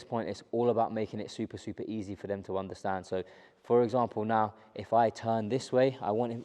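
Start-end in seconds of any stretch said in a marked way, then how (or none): none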